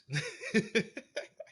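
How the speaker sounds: high-pitched giggling laugh; real high chuckle; amused laugh. Real, not acted. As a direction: crack up at your own answer. Laughs after naming Flobots.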